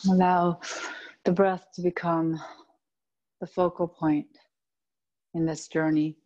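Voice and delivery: calm, even yoga nidra voice with long pauses; steady, low-key meditation guide, phrase by phrase; guided meditation. Acted. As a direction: lead them inward, unhurried, letting each phrase rest.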